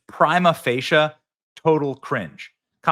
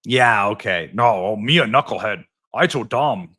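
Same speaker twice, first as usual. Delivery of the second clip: dopey Tim Walz; 5 semitones lower than usual. Retold or imitated. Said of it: "This is what Tim Walz always said".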